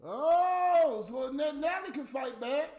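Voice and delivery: loud and high, caught off guard; excited shout at Natalie's comeback; excited outburst. Real, not acted. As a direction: burst out in surprised delight. Excited reaction to her reply.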